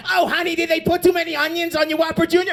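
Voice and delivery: high, fast doting mother voice; a fussing mom soothing her boy; mock sweet mom.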